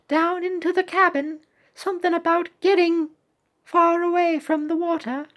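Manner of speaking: high-pitched cartoon reply; little cookie. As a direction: report back in a high little voice. Voices cookie answering captain.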